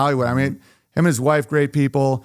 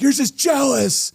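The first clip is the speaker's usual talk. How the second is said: high, slow whiny retort; high mocking whine; mock special boy; 9 semitones higher, slower than usual. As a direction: play the touchy guy in fancy jeans. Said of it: "they're like".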